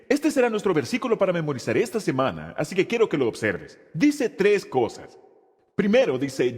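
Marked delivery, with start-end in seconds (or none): none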